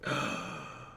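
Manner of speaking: sharp breathy gasp of shock; dramatic gasp. Acted. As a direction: gasp like you just saw a scandal. Surprised gasp at reveal.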